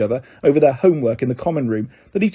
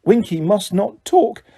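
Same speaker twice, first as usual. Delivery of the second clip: angry; 6 semitones higher than usual. A narrator's reading. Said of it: "said Dobby angrily".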